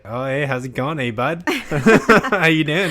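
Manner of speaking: loud, high hammed-up Canadian accent. Friend mimics Canadians to co-host, exaggerated Canadian accent. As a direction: laugh through a mock Canadian accent. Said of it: "like, Canadian accents".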